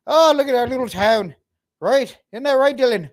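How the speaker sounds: high, loud Irish villager impression; mock townsfolk. Acting out Irish townsfolk chatting.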